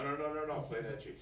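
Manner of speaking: usual speaking voice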